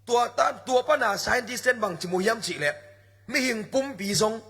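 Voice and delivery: ordinary talk, nothing marked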